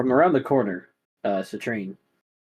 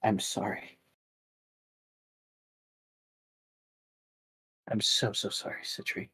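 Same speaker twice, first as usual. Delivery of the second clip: tearful apology; 3 semitones lower, 10 dB quieter than usual. Acted. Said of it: "tears run down her face".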